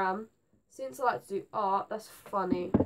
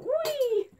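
mock whee; 4 dB louder than usual. Ruler flying into wall.

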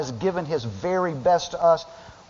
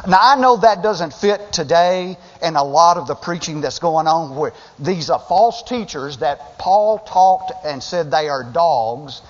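ordinary talk

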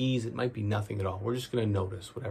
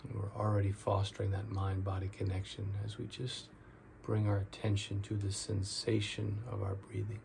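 guided meditation; 7 dB quieter than usual. Real, not acted. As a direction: ease them into each breath, drawing out the key words.

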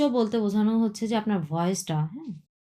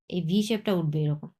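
usual speaking voice

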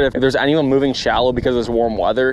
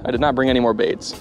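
ordinary talk

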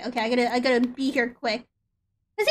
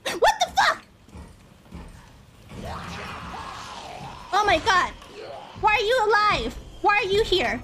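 raging gamer; 6 semitones higher than usual. Real, not acted. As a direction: rage at the game in disbelief. Enemy back alive, raging gamer.

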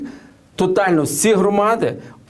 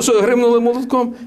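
higher-pitched mock disbelief; retold incredulous question; 7 semitones higher than usual. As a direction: balk at the price in a raised pitch. Customer balking at the price, retold.

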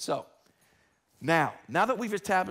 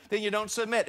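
ordinary delivery

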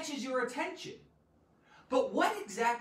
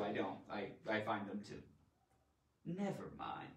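ordinary delivery

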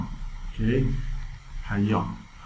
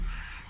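long voiceless hissed s; soft sustained s sound; isolated S sound; 16 dB quieter than usual. Shown as a demonstration. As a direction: hold a bare "sss" with no vowel.

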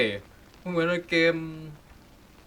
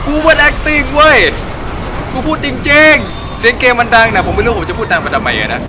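loud and high, half laughing at himself; much louder and higher, slow at first; talking loud; 10 semitones higher, 15 dB louder, slower than usual. Real, not acted. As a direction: keep shouting over the game sound.

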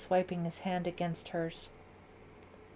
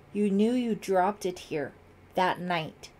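louder, accusing demand; 6 dB louder than usual. A narrator's reading. Ellie confronts Brett over the button, demanding. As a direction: confront them with it, voice raised. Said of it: "Ellie demanded".

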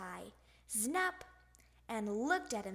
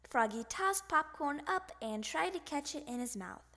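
usual speaking voice

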